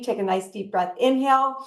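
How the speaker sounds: normal talking voice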